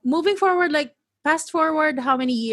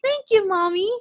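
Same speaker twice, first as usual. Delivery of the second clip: gushing; 9 semitones higher than usual. Real, not acted. Gushing about contented kid.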